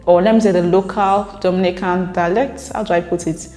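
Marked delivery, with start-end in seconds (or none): none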